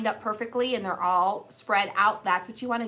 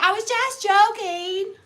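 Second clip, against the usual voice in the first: high, drawn-out mock protest; 9 semitones higher, 6 dB louder, slower than usual. Wife re-enacting her excuse to husband, mock protest. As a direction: whine it out slowly, exaggerating the excuse. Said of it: "And I'm like".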